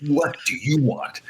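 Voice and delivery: slow, menacing tough-guy drawl, lightly voiced; a hint of an annoyed enforcer squaring up; slow gruff challenge. Opponent glaring after being punched, slow mock-menacing challenge. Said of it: "Like that, you know".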